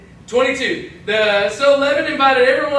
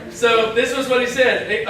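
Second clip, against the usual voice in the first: ordinary talk, nothing marked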